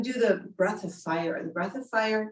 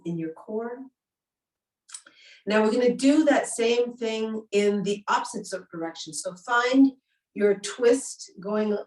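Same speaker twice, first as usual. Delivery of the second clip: louder, pumped-up class cues; energetic yoga instructor; 2 semitones higher, 4 dB louder than usual. Acted. Leads breath of fire.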